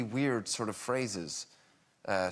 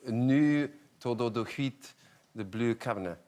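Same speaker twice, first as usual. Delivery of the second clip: bad Swedish. Shown as a demonstration. Actor attempting a Swedish idiom, apologetically bad Swedish. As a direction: stumble through it in badly mangled Swedish. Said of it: "My Swedish is really bad".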